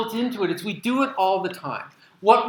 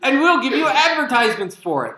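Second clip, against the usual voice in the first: loud, higher, laughing through the words; laughing punchline; 6 semitones higher, 7 dB louder than usual. Pretend. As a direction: repeat the joke while laughing at it.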